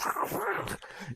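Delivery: high imitated rattling noise; bumpy road noise. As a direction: mimic driving over a dirt road.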